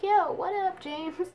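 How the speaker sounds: mock flirty hello to the guy who defended her; flirty greeting. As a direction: greet the cute guy with a coy lilt.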